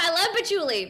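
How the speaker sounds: real laughter bubbling into the words; laughing. Real, not acted. Speaker teasing about patchouli to host, laughing. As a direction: say it through a high, loud laugh.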